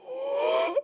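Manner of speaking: high-pitched anticipatory gasp; mock gasp. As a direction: suck in breath as the eager queen. Imitating queen's anticipation.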